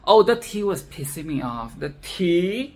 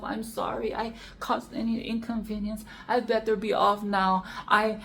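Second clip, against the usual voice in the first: higher, softer self-pitying mock apology; mock martyr whine, higher and softer; imitating a martyr; 4 semitones higher, 6 dB quieter than usual. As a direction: play the poor-me martyr, higher and soft. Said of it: "She played the martyr card down".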